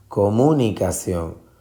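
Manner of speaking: comunicación with MU punched, co-MU-nicación; stress on second syllable. Shown as a demonstration. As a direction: move the force to mu in comunicación. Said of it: "si usted se va a buscar". (comunicación con fuerza en la sílaba MU)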